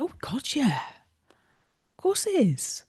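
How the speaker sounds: high, slow, breathy-hushed "of course"; lightly mock her shrugging it off as obvious; a hint of a posh therapist, slow and high; lightly voiced posh therapist. Acupuncturist voiced in story.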